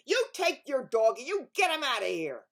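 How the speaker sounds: loud shouted order; angry yell. Acted. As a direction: yell at the neighbour. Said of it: "the man yelled".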